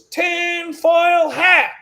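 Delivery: a long, high, hammed-up shout of "Tinfoil hat"; mock shout. Vlogger mocking his own old tinfoil hat, hammed-up high shout. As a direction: stretch "Tinfoil hat" into a big mock cry.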